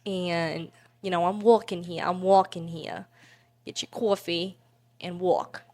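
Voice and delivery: punchy New York accent, dropped r's; New York street accent demo. Shown as a demonstration. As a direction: talk like a brash New Yorker. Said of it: "So that's New York".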